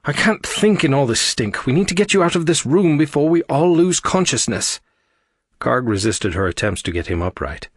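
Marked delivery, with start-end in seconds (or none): 0.0-4.8 high, loud exasperated urging; frustrated, pitched-up pleading. A narrator's reading. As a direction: urge someone up, out of patience. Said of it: "Frustrated by Karg's silence".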